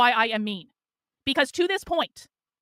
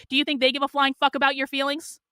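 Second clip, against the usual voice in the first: ordinary talk, nothing marked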